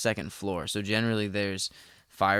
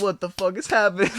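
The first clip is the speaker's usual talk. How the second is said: high, loud panicked outburst; retold freak-out; 10 semitones higher, 7 dB louder than usual. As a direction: blurt it out in alarmed disbelief. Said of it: "freaking out".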